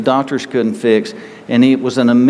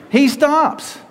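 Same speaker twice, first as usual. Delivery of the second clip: dramatic reveal; 11 semitones higher than usual. Acted. Dramatic turn in sermon.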